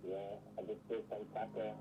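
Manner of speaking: muffled remote voice. Real, not acted. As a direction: voice muffled by headphones. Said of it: "You sound muffled".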